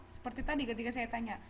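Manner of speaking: usual speaking voice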